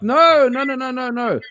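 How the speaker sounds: high, loud insistent no; a jokey high-pitched protest; emphatic no. Retold or imitated. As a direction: shoot the idea down hard. Him cutting in to push Die Antwoord.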